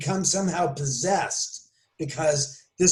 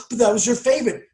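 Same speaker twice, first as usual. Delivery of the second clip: higher, louder mock objection; imagined objector; 6 semitones higher, 4 dB louder, faster than usual. Host's imagined critic protesting, mildly mocking. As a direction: play the naive believer protesting. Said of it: "if you say".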